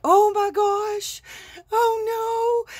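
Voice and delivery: high, loud, slow oh my gosh; reliving the shock of the crash; high panicked cry of dismay; panicked gasp. Retold or imitated. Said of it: "And I just went".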